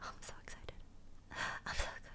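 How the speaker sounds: soft excited whisper; whispered excitement. Real, not acted. Telling viewers about the new cat, giddy whisper. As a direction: breathe it out like a giddy secret.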